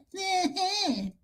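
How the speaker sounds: laughing. Real, not acted. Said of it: "laughing at the impression".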